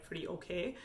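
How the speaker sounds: ordinary talk, nothing marked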